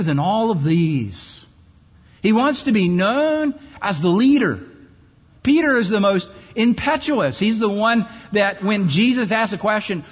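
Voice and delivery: loud, driving sermon voice; full-force preaching. Acted. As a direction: hammer each line home to the crowd.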